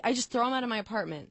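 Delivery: ordinary talk, nothing marked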